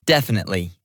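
ordinary delivery

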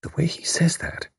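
whispered inner thought. A narrator's reading. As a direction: whisper it to yourself. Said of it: "Tuppence thought".

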